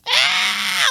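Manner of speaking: short high imitation of the drone scream; mock scream. Echoing the girl's scream from the video, a short high mock yelp. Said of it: "I love that blood-curdling scream".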